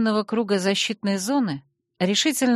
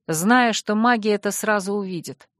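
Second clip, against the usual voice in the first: plain reading voice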